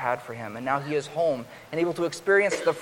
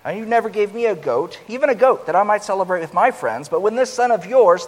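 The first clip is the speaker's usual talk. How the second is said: loud, resentful, rising indignation; bitter angry outburst; 6 semitones higher, 8 dB louder than usual. Acted. Older son to his father, loud resentful outburst. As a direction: hurl the complaint, louder. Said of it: "he responded bitterly and angrily".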